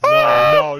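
high, loud frantic cries; panicked no. Real, not acted. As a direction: scream no in panic. Panicking at shark.

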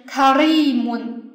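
karimun with a long, weighted "ri"; stress on RI. Shown as a demonstration. Teacher repeats the reading for students, stress on "ri" in karimun. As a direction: stretch and stress the middle of karimun, "ri". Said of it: "重音在리上面".

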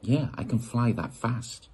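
ordinary delivery

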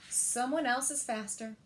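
plain reading voice

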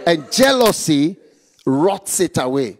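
usual speaking voice

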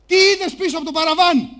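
booming host question, very loud; host demanding answer. Acted. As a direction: call it out to the whole studio. Host demands answer before audience.

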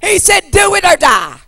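loud, high pleading preacher's cry to the Lord; full-force preaching. Acted. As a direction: shout the prayer out to God.

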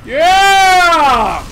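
loud drawn-out cheer; excited cheer. Real, not acted. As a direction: shout with triumph.

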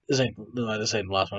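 ordinary talk

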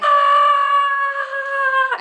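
sudden high pained yelp, loud; loud high scream of pain. Retold or imitated. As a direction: yelp as if hit in the eye. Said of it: "The guy is like".

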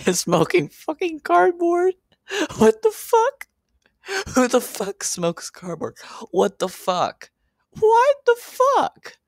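cracking up in high squeals at the cardboard; incredulous shriek. Real, not acted. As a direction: shriek it in laughing disbelief. Laughing disbelief at cardboard.

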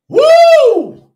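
sudden high loud whoop of excitement. Real, not acted. Preacher whooping to fire up the congregation, high and loud. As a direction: throw out a joyful preacher's whoop.

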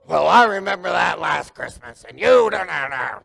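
lightly voiced grumbling relative; mock quarrelling relative. Relative to family at Christmas, mock grumbling over last year. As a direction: act out someone dragging up an old family grudge.

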